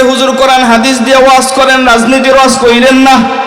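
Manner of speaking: high mocking imitation of politicians; mocking leaders. Preacher mimics leaders telling clerics to avoid politics, high. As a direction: imitate them with a sneer. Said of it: "কয়".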